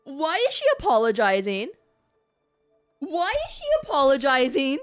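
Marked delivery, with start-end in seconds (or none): none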